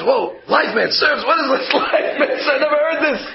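a guest thrown by an odd phrase; retold astonished guest. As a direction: react in amused disbelief.

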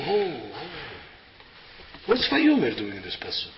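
normal talking voice

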